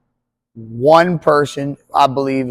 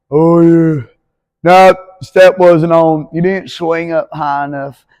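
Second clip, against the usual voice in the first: raised, louder southern drawl of a lazy coach; southern accent; 3 semitones higher, 6 dB louder than usual. Acted. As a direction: call it out from the chair in a southern accent. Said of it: "I gave him a southern accent".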